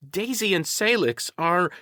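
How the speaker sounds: high, surprised half-question; high-pitched astonished discovery; dawning realization. A narrator's reading. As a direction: start asking as the answer hits you. Lou realizes the answer mid-question.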